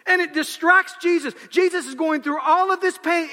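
full-force pulpit climax; fiery preaching. Acted. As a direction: build the crucifixion to a shout.

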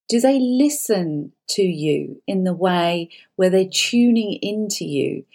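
ordinary delivery

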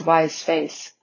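ordinary delivery, nothing marked

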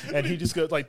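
ordinary talk

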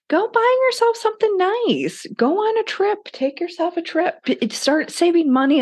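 squeaky high cheerleader voice, brighter; playful high-pitched pep voice; high mock voice. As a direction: chirp the treat ideas in a silly high voice.

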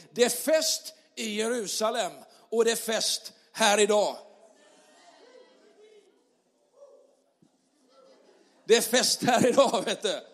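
loud, joyful preacher's call; festive preaching. Acted. Pastor rallies the church to celebrate, loud and joyful.

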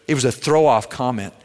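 ordinary delivery

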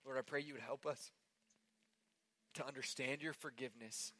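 quiet prayer. Acted. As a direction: pray gently over the room. Leads closing prayer.

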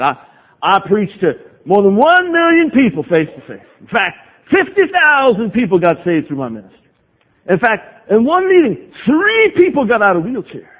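hammed-up Texas evangelist, swooping up on the numbers; mock Texan accent. Texas evangelist boasting of his ministry, mock Southern accent. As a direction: boast like a Southern revival preacher, badly faked accent. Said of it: "Forgive my poor Southern accent".

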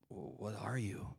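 slow, low, very soft giant; dismissive Goliath. Acted. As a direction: puzzle quietly over a puny challenger. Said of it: "Goliath is like".

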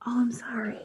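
whispered, breathy apology under the breath; soft whispered sorry after a slip; embarrassed whisper. Real, not acted. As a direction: drop to a sheepish whisper. Embarrassed apology after being corrected.

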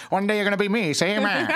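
put-on 1920s Chicago mobster accent, high and loud through laughter; laughing gangster voice. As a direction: ham up the old-time gangster while cracking up. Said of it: "use that voice".

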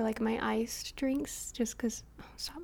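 slow whispered aside; whispering. Real, not acted. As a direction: whisper it, a little embarrassed. Embarrassed aside about herself.

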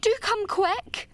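high, slow, frightened plea; nervous pleading. A narrator's reading. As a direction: beg her to hurry, scared. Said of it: "implored Diana nervously".

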